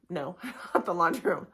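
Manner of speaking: correcting herself while laughing. Real, not acted. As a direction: laugh through the slip.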